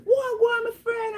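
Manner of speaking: very high-pitched mock greeting; boisterous greeting. Greeting the friends down there, boisterous and high-pitched. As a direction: hail a friend in a squealed, high voice.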